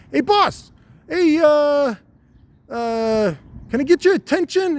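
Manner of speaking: a high little testicle politely asking the boss for attention; talking testicle. Acted. A high-voiced testicle calling up to its owner. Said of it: "They're like".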